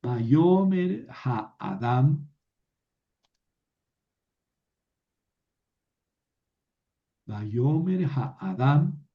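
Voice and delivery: very slow, careful Hebrew chanting; slow demo reading. Shown as a demonstration. Teacher models the verse slowly for the class. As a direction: read it out slowly for students to follow. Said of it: "aquí les pongo un ejemplo".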